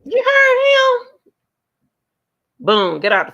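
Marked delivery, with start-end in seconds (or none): 0.0-1.1 a high, stretched, disbelieving "You heard him?"; incredulous squeal. Real, not acted. Streamer shocked at what TJ said on the clip, squealing high and slow.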